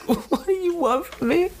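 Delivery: high, wailing sob-voice; crying half-elf. Acted. Half-elf sobbing at the party from the floor. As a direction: whimper it out like a broken captive. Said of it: "you can hear him, like, kind of cry".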